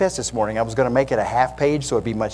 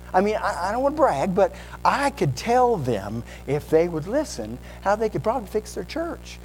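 bragging know-it-all; 8 semitones higher than usual. Acted. Preacher playing a bragging know-it-all.

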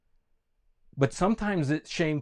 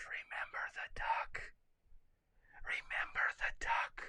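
accusing whisper; 15 dB quieter than usual. Acted. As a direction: whisper it like a nagging inner voice. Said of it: "that whispers".

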